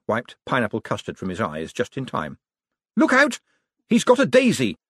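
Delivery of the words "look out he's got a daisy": high, loud alarm; sudden high-pitched cry of danger; shouted warning. A narrator's reading. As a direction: yell an urgent warning. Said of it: "he shouted".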